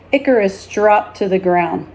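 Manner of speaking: usual speaking voice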